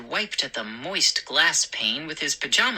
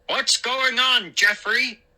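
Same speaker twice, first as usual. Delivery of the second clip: raised, high-pitched call; shouted question; 8 semitones higher than usual. Acted. McCall calling to his neighbor, mild shout. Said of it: "I heard Mister McCall shout".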